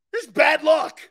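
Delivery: loud mocking shout; loud rant. Real, not acted. Ranting to fans, loud mocking shout. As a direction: yell it with scorn.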